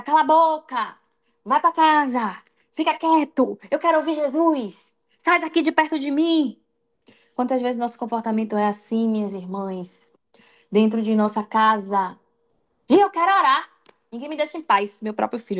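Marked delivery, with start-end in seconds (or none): 0.0-6.6 mock yell. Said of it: "a gente vai gritar". 12.9-15.0 fed up, wanting peace to pray; mock exasperation. Mother voicing herself, irritated.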